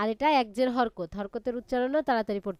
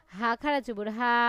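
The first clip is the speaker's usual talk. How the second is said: usual speaking voice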